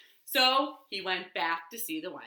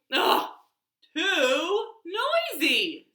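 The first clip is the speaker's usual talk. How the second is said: slow, drawn-out fed-up whine; exasperated complaint; 7 semitones higher, 6 dB louder, much slower than usual. Acted. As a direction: stretch it out, fed up. Peter fed up.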